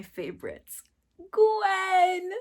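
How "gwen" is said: high, loud drawn-out name cry; excited cheer. Real, not acted. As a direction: cheer the favorite's name like a fan. Excited intro of favorite character.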